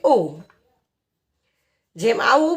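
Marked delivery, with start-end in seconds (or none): 0.0-0.4 ઓ as a plain "oh"; phonic O sound. Shown as a demonstration. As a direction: sound out ઓ just like the letter's name. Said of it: "ઓનો sound થાય છે". (ઓ નો phonic ઉચ્ચાર)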